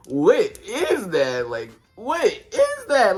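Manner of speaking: loud, very drawn-out incredulous question; hammed-up, slow, baffled outcry; exasperated disbelief. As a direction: stretch every word out in loud disbelief. Exasperated reaction to his speech.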